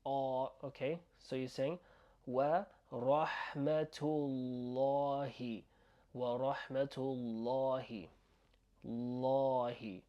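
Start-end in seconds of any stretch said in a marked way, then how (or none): 2.2-10.0 slow teaching demo, syllables drawn out; slow, exaggerated careful pronunciation; overly emphasized. Shown as a demonstration. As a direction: stretch each long vowel for learners to follow. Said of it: "overly emphasized so you can try to follow".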